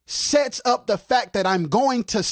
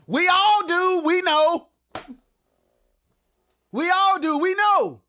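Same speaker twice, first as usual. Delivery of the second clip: high, loud sarcastic reply; singsong we all do, we know, much higher; mock agreement; 10 semitones higher, 4 dB louder than usual. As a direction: throw it back with a sarcastic edge. Mocking reply to quote.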